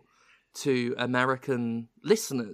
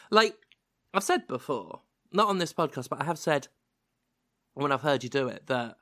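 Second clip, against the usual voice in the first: ordinary talk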